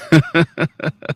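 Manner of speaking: short chuckle; laugh. Real, not acted. Preacher ranting to the congregation pauses, short chuckle. Speaker's own laugh amid rant.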